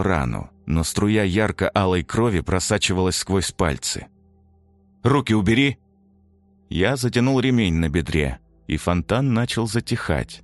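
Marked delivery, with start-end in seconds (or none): 5.0-5.8 sharp command, louder and higher; urgent order. A narrator's reading. Soldier ordering his bleeding friend, urgent shout. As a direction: bark it at him.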